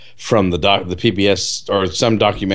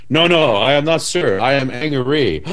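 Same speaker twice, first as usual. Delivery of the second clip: put-on Chinese accent, "angry" as his name; hammed-up Chinese accent correcting the waiter; mock Ang Lee accent; 4 semitones higher than usual. Ang Lee correcting the waiter, mock Chinese accent. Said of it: "impression of Ang Lee".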